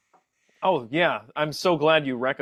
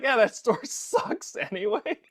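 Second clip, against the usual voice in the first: mock consoling; 6 semitones higher, 4 dB quieter than usual. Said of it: "like, to cheer him up".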